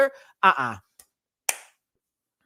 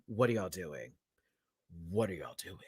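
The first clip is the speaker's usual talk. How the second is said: much lower and far softer, near a mutter; fading disbelief; 7 semitones lower, 13 dB quieter than usual. Real, not acted. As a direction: let it die away in disbelief. Repeats question, trailing off.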